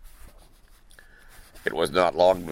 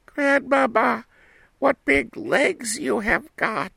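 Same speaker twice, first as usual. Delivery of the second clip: put-on very high, slow wondering girl; little girl voice. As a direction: ask like an astonished small girl. Girl questions.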